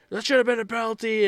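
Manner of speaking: high, quick imitation of a whining player; whiny complaint. Player whining at the ref, high mock complaint. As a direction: squeak it out fast like a sore player. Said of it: "you can hear Skinner just like".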